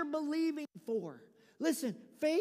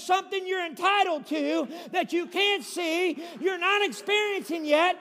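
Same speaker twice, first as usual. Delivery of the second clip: loud, higher shouted climax; loud climax ending higher; full preaching shout; 4 semitones higher, 9 dB louder than usual. Acted. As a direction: preach it at full force to the crowd.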